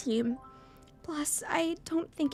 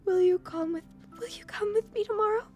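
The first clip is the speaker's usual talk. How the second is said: higher, halting, softening request; composing after sobs; 8 semitones higher than usual. Acted. Emmy inviting Hisao home, more composed after crying. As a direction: ask tentatively, settling down from tears. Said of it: "a little more composed".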